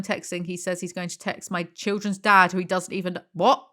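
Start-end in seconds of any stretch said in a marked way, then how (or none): 3.3-3.7 sharp rising what; incredulous what; baffled what. Real, not acted. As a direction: caught off guard by the text. Baffled reading the texts.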